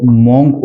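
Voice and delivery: मॉन्क with a round "o"; common mispronunciation. Shown as a demonstration. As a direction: say मॉन्क as "mawnk". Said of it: "उच्चारण है ये गलत है".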